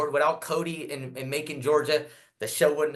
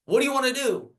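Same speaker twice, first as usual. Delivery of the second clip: high, bright announcer voice lifted well up; host's question; 10 semitones higher than usual. Retold or imitated. Host asking what record comes next, pitched high. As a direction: voice the host pumping up the crowd.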